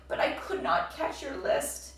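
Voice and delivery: breathless whispered words; gasped. A narrator's reading. Frog tells Toad, breathless and whispering, he failed. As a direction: push it out on no breath. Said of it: "gasped Frog".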